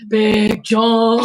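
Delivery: loud, booming call of the name. Pretend. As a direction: announce him like a ring name. Calling out the name.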